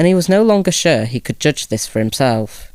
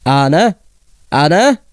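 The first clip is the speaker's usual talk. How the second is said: louder call to the maid, rising; father calling; 3 semitones higher, 4 dB louder than usual. A narrator's reading. Father calls the maid through the hall, loud and rising. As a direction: shout toward the kitchen. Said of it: "his father called into the kitchen".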